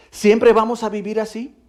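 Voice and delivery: high, loud pleading cry; anguished cry. Retold or imitated. The daughter crying out to her mother, anguished and loud. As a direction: burst out in despair.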